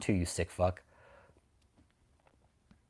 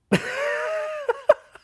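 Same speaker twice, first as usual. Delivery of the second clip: high burst of laughter; 4 dB louder than usual. Real, not acted. Laughs at own remark.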